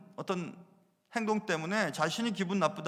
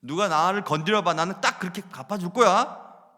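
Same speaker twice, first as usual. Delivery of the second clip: louder taunting dare; louder mock-threatening payback vow; defiant threat; 7 dB louder than usual. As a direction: challenge anyone to try it. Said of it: "이런 태도를 가졌습니다".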